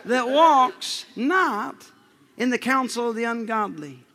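very slow, drawn-out reading; slow scripture reading. Acted. Preacher reading scripture slowly to the church. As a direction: stretch each word of the verse.

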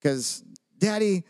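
ordinary talk, nothing marked

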